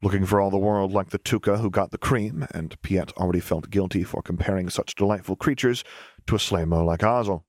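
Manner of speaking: plain reading voice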